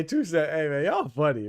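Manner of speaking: ordinary talk, nothing marked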